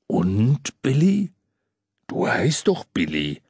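Santa voice. A narrator's reading. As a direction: ask him fondly. Santa to Billy.

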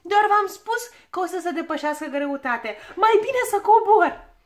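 triumphant relief at getting out of the flight; gleefully. A narrator's reading. Scared dwarf glad he can climb out, gleeful shout. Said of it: "spuse bucuros".

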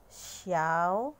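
"xiao" as one blended syllable with a rising finish; teacher blending "xiao" for students; Chinese xiao. Shown as a demonstration. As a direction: glide "xiao" together, lifting at the end. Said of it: "Kung paano siya basahin".

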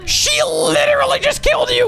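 high-pitched, disbelieving shout; shocked gamer yell; excited outburst. Real, not acted. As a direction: exclaim it way up high. Mock outrage at game kill.

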